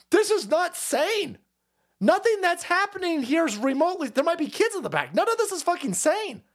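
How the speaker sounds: high, exasperated exclamations; appalled disbelief. Real, not acted. Host worried about kids in the back, appalled. Appalled at car being destroyed.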